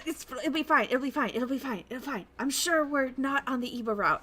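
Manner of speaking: self-reassuring. Pretend. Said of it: "I remember the style going exactly".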